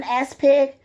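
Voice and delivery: usual speaking voice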